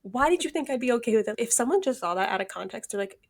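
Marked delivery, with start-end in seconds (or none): 0.0-1.4 frustrated rant. Retold or imitated. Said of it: "it's literally being like".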